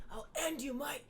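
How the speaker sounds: high put-on Irish tough-guy voice; lightly voiced Irish threat; Sheamus impression. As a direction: threaten in a mock Irish brogue. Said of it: "he cuts good promos".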